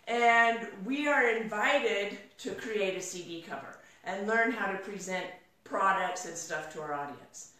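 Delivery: normal talking voice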